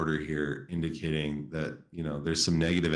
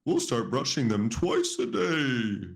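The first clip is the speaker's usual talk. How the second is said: higher, gentle owl voice; kind owl; 7 semitones higher than usual. Acted. Ollie comforting Fuzzy, higher and gentle. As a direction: reassure him as the kindly owl. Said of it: "Ollie smiled kindly".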